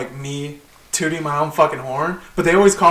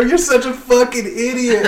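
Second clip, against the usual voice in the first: higher-pitched mock scolding; retold going off; 7 semitones higher than usual. Worker going off on his boss, high scornful retort. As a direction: lay into him with raised pitch. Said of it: "start going off on him".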